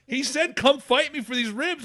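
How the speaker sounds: high whiny complaint; mock pleading to police. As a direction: act wronged and innocent.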